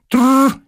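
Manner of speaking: high rolled trill for splintering wood; imitated cracking noise. A narrator's reading. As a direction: imitate crates cracking open. Imitated crack of crates being broken. (изобразить треск раскатистым «р»)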